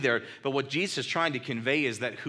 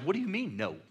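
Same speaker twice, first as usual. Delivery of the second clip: miffed; 5 semitones higher, 4 dB quieter than usual. Retold or imitated. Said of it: "I was miffed".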